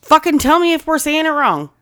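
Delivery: loud, high-pitched call to a listener. Real, not acted. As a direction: shout out for help from afar. Calling out a listener by name.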